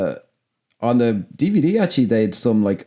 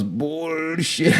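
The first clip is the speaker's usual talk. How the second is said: higher, drawn-out hero retort; Arnie impression; 5 semitones higher, 4 dB quieter than usual. Podcaster savouring the film line for co-host, higher drawn-out impression. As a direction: stretch it out as a hammed-up impression, laughing after. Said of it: "the way he goes".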